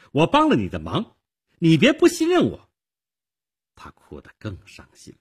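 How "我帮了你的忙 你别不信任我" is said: high, loud reproach rising higher; stern accusation. A narrator's reading. Girl who helped Nick reproaches him, accusing sharply. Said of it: "少女厉声指责着".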